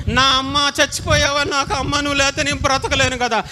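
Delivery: lightly imitated mourner's wail; mourning cry. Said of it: "ఇష్టమైన వ్యక్తి చచ్చిపోయినప్పుడు ఎలా ఏడుస్తాడో". (అమ్మ పోయినట్లు కొంచెం ఏడుపు స్వరం)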